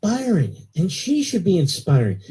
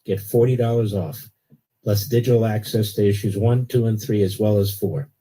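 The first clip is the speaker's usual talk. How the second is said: steady, lower-pitched sponsor read; 6 semitones lower than usual. Acted. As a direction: sell the magazine like a live radio spot. Host reads a magazine ad.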